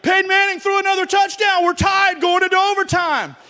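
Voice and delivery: loud, high, thrilled shouting; excited fan. Retold or imitated. A Colts fan shouting back the score, overjoyed. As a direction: shout the news like a fan who can't believe it.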